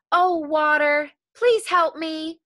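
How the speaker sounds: begging the water to save him; pleading. A narrator's reading. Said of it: "asked the water".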